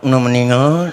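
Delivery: mock nasal slur, consonants blurred in "Una meninggal"; imitating someone who talks through the nose; nasal speech impediment. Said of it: "Yang bindeng aja nggak boleh".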